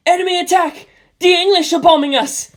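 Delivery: a high, loud alarm call; alarmed shout. A narrator's reading. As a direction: shout the warning as bombs fall. Warrior rushes in shouting.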